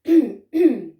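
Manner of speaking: loud, high, pompous throat clearing. Acted. The oldest, wisest ghost about to address the others, pompous throat clearing. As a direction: clear your throat grandly before a speech. Old wise ghost takes the floor.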